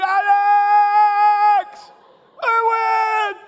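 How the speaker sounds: long high screams for help; full-voiced panicked calling to his brothers; screaming names. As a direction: scream each name out in terror, stretched. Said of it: "Screaming my brother's name".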